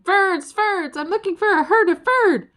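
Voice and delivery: high, loud calling shout; yelling again. Acted. Ferd yelling again for a herd of Ferds, high and loud. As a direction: cup your hands and call out. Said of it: "cupped his hands and yelled again".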